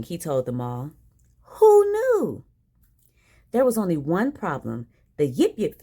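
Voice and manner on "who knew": high, loud exclamation; mock surprise. Santa's reaction to the sleigh news.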